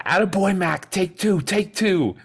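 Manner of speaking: high, loud cheering voice all through; acted movie quote. Podcaster cheering as the movie character, high and loud.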